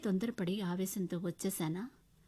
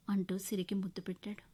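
ordinary delivery, nothing marked

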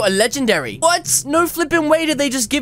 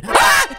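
sudden high yelp of fright; real jump-scare scream; startled scream; 12 semitones higher, 3 dB louder than usual. Real, not acted. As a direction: shriek as the killer jumps out. Startled by the murderer appearing.

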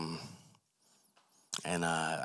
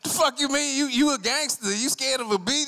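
much higher, louder incredulous scolding; mock disbelief; 6 dB louder than usual. Friend mocking the gangster over the bee, loud teasing. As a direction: needle him for being scared.

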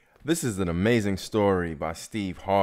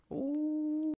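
high angry ooh; 4 dB quieter than usual. Retold or imitated. Steve fuming in his mind.

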